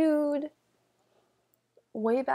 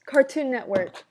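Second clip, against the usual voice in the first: loud, raised CARTOON NETWORK in one run; loud, higher-pitched channel plug; emphatic; 4 semitones higher, 9 dB louder than usual. Real, not acted. Names the channel, then repeats it.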